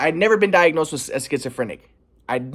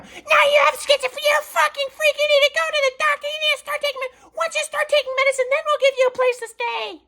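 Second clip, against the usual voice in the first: mock screaming mom. Mom screaming insults at her son, mocked shriek. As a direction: play the raging mom, hammed-up shriek. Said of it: "Screaming at me".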